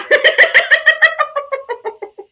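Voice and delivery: high, loud burst of real laughter; cracking up helplessly at her own joke; big laugh. Real, not acted. Cracking up with her co-host over the shit pie joke, a high loud laugh.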